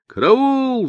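very high-pitched cry for help; high, sustained yell of alarm. A narrator's reading. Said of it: "заорал Квадриго".

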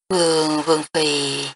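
mildly astonished stammer. A narrator's reading. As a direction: catch on the first word, then restart. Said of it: "Hồ Thái Y bắt đầu lắp bắp". (lắp bắp nhẹ vì kinh ngạc)

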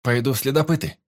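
high-pitched proud declaration. Acted. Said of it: "гордо заявил Марти".

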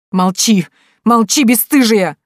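loud tearful cry of shame; sobbing scold. Acted. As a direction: wail it through sobs at the daughter. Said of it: "всхлипнула Степанида Пантелеймоновна". (со всхлипом, с укором)

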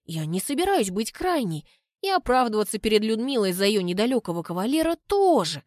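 plain reading voice

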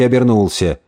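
ordinary delivery

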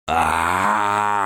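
long hellish beast scream; a furious beast's howl; devil's roar. A narrator's reading. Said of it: "Крик дьявола был подобен предсмертному воплю".

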